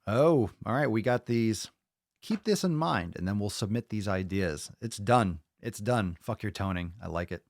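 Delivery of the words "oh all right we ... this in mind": normal talking voice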